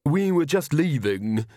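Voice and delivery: haughty lady dismissing an underling. A narrator's reading. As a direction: draw it out snootily. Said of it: "said Magrat haughtily".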